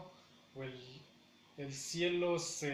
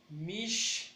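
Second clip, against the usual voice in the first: "Mishti" split as MISH, ti; higher, held MISH, then a gap; syllable by syllable; 4 semitones higher than usual. Shown as a demonstration. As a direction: separate the two beats of "Mishti". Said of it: "Lleva mayor fuerza en el".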